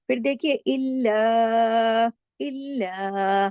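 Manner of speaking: इल्ला said very slowly with the doubled ल held; held doubled lam. Shown as a demonstration. Teacher shows the doubled lam in इल्ला to students. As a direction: stretch इल्ला, pressing the doubled ल. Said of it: "तशदीद है".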